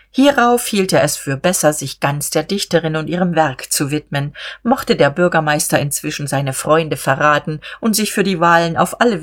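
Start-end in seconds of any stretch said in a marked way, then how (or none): none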